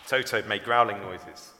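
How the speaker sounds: plain reading voice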